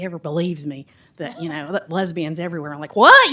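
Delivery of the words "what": high, loud incredulous yelp; incredulous outburst. Acted. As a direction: burst out in exaggerated disbelief. Mock disbelief at not being believed.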